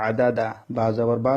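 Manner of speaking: ordinary talk, nothing marked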